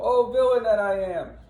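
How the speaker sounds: high, loud wounded wail; screaming in the night. Acted. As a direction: cry out as a wounded villain. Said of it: "the men screaming in the night".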